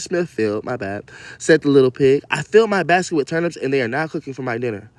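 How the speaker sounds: lightly performed storybook reading; story reading. Acted. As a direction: read the fairy tale out to the kids. Reads story aloud to kids.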